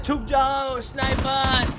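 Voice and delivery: high, loud in-game callout voice; parroting the game's announcer warning; imitating game voice line. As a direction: mimic the game character shouting a warning. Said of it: "वो English में बोलता है".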